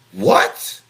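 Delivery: sharp, loud shocked "What?". Retold or imitated. Storyteller shocked at a stripping license, loud disbelief. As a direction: hit it like a slap of disbelief.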